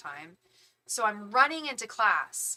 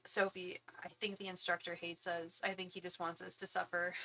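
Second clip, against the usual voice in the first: hushed complaint; 8 dB quieter than usual. Retold or imitated. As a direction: whisper the complaint under your breath. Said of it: "The other model was just like".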